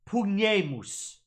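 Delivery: "Pugnemus" slowly, with the ay sound in the middle; wrong vowel demo. Shown as a demonstration. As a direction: drag out "Pugnemus" with poog-NAY-moos. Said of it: "That A sound is not correct".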